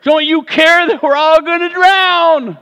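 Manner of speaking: high, loud frightened cry of the disciples; panicked shout. Retold or imitated. Disciples shouting at sleeping Jesus, high panicked cry. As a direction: cry out as men about to drown.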